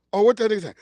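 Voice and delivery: ordinary talk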